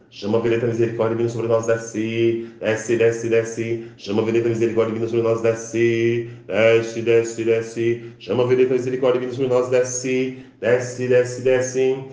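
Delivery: fast, rattled-off invocation; rapid repeated chant; accelerated decree. Shown as a demonstration. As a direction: speed it up, repeat quickly. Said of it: "Cada vez mais acelerando".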